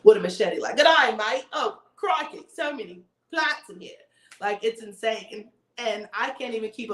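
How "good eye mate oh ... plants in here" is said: put-on lightly done Australian accent, a bit higher; mock Aussie wildlife host, Australian accent; Crocodile Hunter. Hosting a live stream, playing Aussie jungle hunter in a mild Australian accent. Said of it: "I literally feel like Crocodile Hunter".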